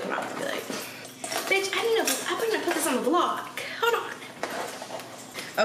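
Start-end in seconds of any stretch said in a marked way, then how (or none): 1.5-5.7 high, laughing mock squeal at a friend; excited squeal. Telling her friend she'll put this on the vlog, high laughing squeal.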